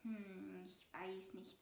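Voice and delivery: low, soft hesitant murmur; mumble, unsure; murmured hesitation. A narrator's reading. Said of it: "murmelt der Mäusegroßvater". (leises, zögerndes Murmeln)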